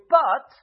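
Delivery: loud stressed but; a big but; emphatic but. Real, not acted. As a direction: lean hard on but. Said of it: "this is the big but".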